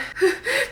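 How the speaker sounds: a high burst of laughter. Real, not acted. Laughing at a stubborn nail polish bottle, high giggle.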